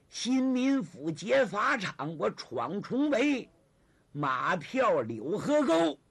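slow, stretched, ringing phrases; grand, drawn-out delivery; declamatory recounting. Acted. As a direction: proclaim each exploit like a storyteller's set piece. Zhang recites his past exploit.

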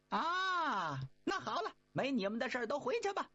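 high, quick monkey voice; high-pitched eager Monkey King. Acted. Monkey King asking brothers about master, high and quick.

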